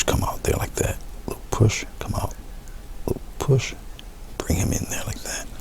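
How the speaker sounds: soft whispered guidance to the brush; whispering. Real, not acted. Painter coaxing each petal out in a whisper. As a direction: hush it out stroke by stroke, unvoiced.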